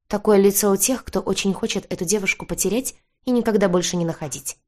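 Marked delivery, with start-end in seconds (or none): none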